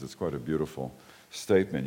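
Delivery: ordinary delivery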